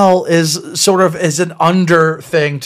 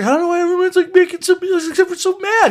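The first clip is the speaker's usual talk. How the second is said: high-pitched whine of a baffled disciple; Peter; 14 semitones higher than usual. Acted. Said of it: "Peter's just like".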